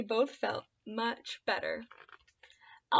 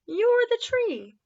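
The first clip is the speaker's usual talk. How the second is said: frightened shout; 12 semitones higher, slower than usual. A narrator's reading. Bear yells at the owl, high startled shout. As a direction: shout it back, spooked.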